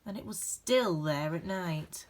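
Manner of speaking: gentle, expressive storybook reading with dramatic pauses; reading to children. A narrator's reading. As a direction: read it like a bedtime picture book for a class. Reads picture book aloud.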